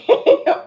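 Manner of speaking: loud, high nervous giggle; a sudden nervous high-pitched laugh; nervous burst of laughter. Real, not acted.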